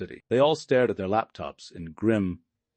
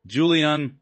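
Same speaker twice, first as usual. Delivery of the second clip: a high, loud cry; cried out; 8 semitones higher, 6 dB louder than usual. A narrator's reading. Panicked wife bursting into the boardroom, high cry. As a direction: call his name out in alarm. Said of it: "Elena cried out".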